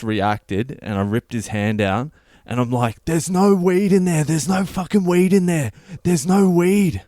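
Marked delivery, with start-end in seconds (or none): 3.1-7.0 loud, high-pitched frantic protest; losing it. Retold or imitated. Storyteller reenacting his outburst at the police officer, losing it. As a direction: yell it in a high, panicked pitch. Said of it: "I just lose it".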